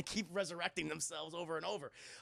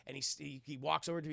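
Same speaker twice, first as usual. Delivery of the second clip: ordinary talk, nothing marked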